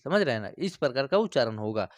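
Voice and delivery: normal talking voice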